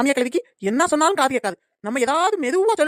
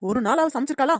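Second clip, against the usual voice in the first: ordinary delivery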